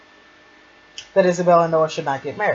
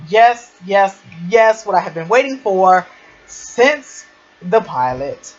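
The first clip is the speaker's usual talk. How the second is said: loud, emphatic fangirl elation; thrilled fan; 2 semitones higher, 5 dB louder than usual. Real, not acted. Fan celebrates the long-awaited love scene, thrilled. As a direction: punch out each yes in triumph. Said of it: "I'm just the happiest fan ever".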